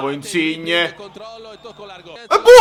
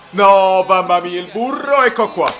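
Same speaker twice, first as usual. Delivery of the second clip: usual speaking voice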